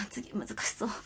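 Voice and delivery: soft breathy aside; whispering. Real, not acted. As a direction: whisper it to yourself.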